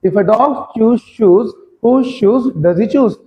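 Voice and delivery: very slow and careful; teacher asking students to listen closely, slowly; slow demo. Shown as a demonstration. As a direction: say it so students can follow. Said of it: "ध्यान से सुनना एक बार मेरे को".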